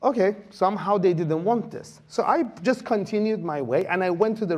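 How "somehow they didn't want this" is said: usual speaking voice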